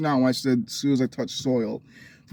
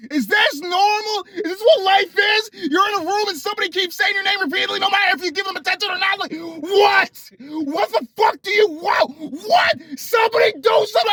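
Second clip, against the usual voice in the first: high, loud, near-crying shouts of exasperation; frantic screaming rant; 3 dB louder than usual. Retold or imitated. Retelling to viewers how the sister's repeating drove her mad, screaming. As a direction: scream it like you're at the end of your rope.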